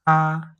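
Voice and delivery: "Ka" held high, level and loud; first tone. Shown as a demonstration. Teacher isolating a syllable, "Ka" high and level. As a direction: sing out "Ka" on one high level pitch. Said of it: "they both have the first tone".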